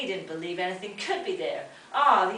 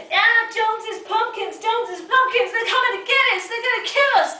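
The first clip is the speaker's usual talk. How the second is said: terrified yelling; 11 dB louder than usual. Acted. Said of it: "we were yelling and yelling".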